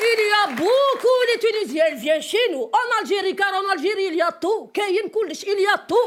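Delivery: high, forceful Algerian boasting; virulent Algerian accent. Shown as a demonstration. Algerian boasts to a Tunisian, virulent Algerian accent. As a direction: push each phrase hard in an Algerian accent. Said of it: "un côté légèrement plus virulent".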